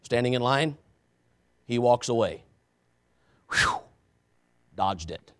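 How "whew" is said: short high whistled breath of relief; quick airy "whew" after a close call; relieved whew. Retold or imitated. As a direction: blow out a mock sigh of relief. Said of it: "That's what I felt".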